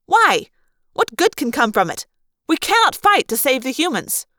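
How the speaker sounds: heated rebuke that peaks on Why. A narrator's reading. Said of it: "Maria said heatedly".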